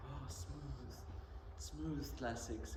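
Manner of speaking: slow, smooth mock radio voice; smooth classical-radio announcer. Teacher mocking classical radio presenters, smooth and slow. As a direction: draw it out like a soothing radio presenter. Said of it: "When they say on the classic F M".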